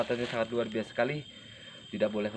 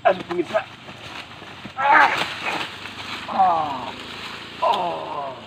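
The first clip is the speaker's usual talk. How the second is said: sudden loud, high gasping cries; terrified cries; 6 semitones higher, 8 dB louder, much slower than usual. Real, not acted. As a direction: cry out as if seized. Struck by unseen force.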